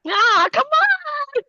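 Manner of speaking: pleading. Real, not acted.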